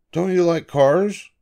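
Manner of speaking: lightly voiced eager boy, pitched high; a grandpa reading young Davy's curious question up high. A narrator's reading. Grandpa voices boy Davy asking his father, high and eager.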